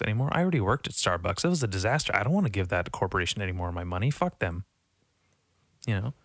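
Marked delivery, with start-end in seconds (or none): none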